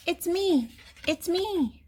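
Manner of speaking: high, excited little duck voice; duck character. A narrator's reading. Duck revealing who is under the mask, high-pitched. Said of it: "says the duck".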